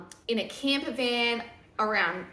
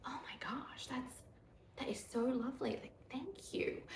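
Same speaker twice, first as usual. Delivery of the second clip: breathy hushed whisper, higher, choked up; teary whisper; 4 semitones higher, 12 dB quieter than usual. Retold or imitated. Touched by husband's kindness, whispering teary thanks. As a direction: whisper it like you're moved to tears.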